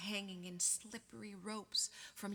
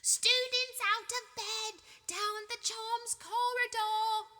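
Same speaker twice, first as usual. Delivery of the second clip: high, loud, slow drawn-out bellow; loud high stretched-out yell; 13 semitones higher, 8 dB louder, slower than usual. A narrator's reading. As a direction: shout to wake the whole castle. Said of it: "Peeves bellowed".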